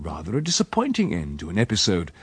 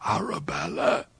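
whispered call up to a window; hushed call; 9 semitones higher than usual. Acted. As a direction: call her name in a whisper at night. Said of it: "tapped softly on the window several times and called".

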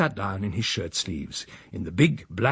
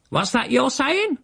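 high, slow screeched challenge; affronted housekeeper; slower than usual. A narrator's reading. Housekeeper challenges the uncle's insult, affronted screech. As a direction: demand it in shrieky offence.

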